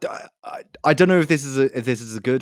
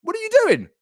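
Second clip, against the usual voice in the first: high squeaky outrage; faster than usual. Acted.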